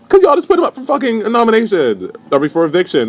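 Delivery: very high, incredulous yell of protest; exasperated outburst. Acted. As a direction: shoot back at them, voice leaping up. Exasperated answer to the clique.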